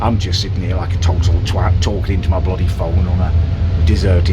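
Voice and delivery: muffled behind a face mask; talking loudly through a mask on a bus. Real, not acted. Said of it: "hopefully you can hear me through my mask".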